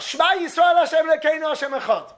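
high, loud desperate call from the cattle car; pleading cry of the prisoner. Retold or imitated. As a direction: cry it out to be heard outside. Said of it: "He called out".

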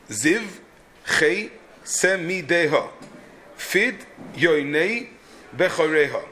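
slow, syllable by syllable; "Ziv, Chei semideha. Fid yoinei bechoreha" beat out with pauses; scanning the meter. Shown as a demonstration. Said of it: "It follows the same thing".

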